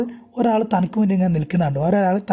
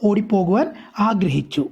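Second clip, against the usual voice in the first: ordinary talk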